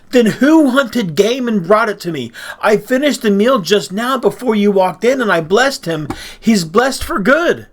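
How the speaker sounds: high, loud, agitated outburst; Isaac shaking violently. A narrator's reading. Father learning he blessed the wrong son, shaking with shock. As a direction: demand it in rising alarm. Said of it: "Shaking violently, he said".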